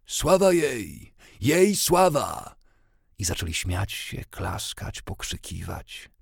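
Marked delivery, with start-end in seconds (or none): 0.1-2.6 high, loud, drawn-out cheer; crowd's acclaim. A narrator's reading. Mourners hailing the seer, loud drawn-out shout. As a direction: call out the glory with full voice. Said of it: "zawołali".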